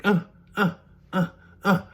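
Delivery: short high mock grunts; imitation of monosyllabic moaning; imitated grunts. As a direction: mimic wordless grunts, spaced apart. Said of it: "you were just like".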